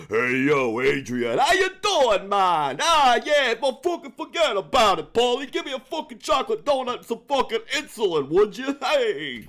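exaggerated Rocky-style accent, mocking; high, loud caricature of someone's jumbled accents; mimicked muddled accent. Mimicking the other person's wandering accent, hammed-up mock accent. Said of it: "six different accents going on there. You're like".

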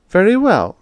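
high, loud clipped reply; huffy landlady; tart. A narrator's reading. Landlady answers her tenant tartly, high and loud. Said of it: "she said a little tartly".

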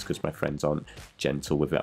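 normal talking voice

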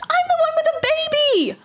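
high-pitched exasperated cry; hammed-up indignation; indignant outburst. Indignant complaint about siblings.

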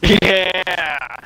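high, loud drawn-out cheer; loud excited holler; whooping yell. Real, not acted. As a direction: let out a long falling whoop.

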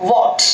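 "what" alone with short rounded o; correct vowel. Shown as a demonstration. Teacher modelling for students, "what" as "wot". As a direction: say a single clear "wot" for "what". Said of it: "what എന്ന് പറയാം".

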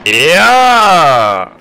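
loud, high cheer; excited cheer. Real, not acted. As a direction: cheer the good volley. Cheer after a volley.

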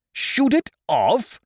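can't believe what he heard; astonished question. A narrator's reading. Doctor still stunned.

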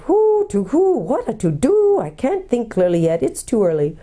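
high hoot sinking into self-talk; muttering owl. A narrator's reading. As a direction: start on a high hoot, trail down to yourself. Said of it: "muttering to itself".

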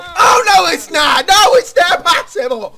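high, loud wailing cry; anguished cry. Acted. Gamer wailing at the impossible level, anguished cry. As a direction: wail that it can't be done.

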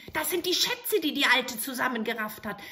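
high, eager little goblin voice; bow and ask for orders; little grey man. Acted. Grey manikin appearing to the soldier, eager.

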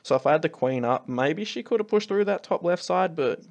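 usual speaking voice

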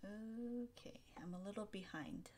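whispered mutter to oneself; barely audible, under the breath while checking; very soft, breathy self-talk; quiet aside. Real, not acted.

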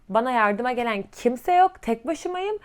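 ordinary talk, nothing marked

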